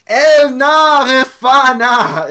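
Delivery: cried out very high and loud, drawn out slow; shouted prayer. Shown as a demonstration. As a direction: call the Hebrew out like a desperate plea to heaven. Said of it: "He shouts out praying".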